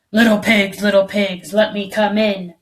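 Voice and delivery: loud wolf bellow. A narrator's reading. Wolf bellowing at the two hiding pigs. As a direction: bellow it at the door of sticks. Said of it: "bellowed the wolf".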